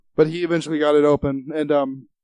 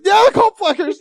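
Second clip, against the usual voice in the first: high, louder, squeezed through laughter; laughing; 7 dB louder than usual. Retold or imitated. Crying into a pillow laughing, high laughing reply. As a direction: barely get it out while cracking up.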